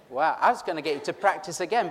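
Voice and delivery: ordinary talk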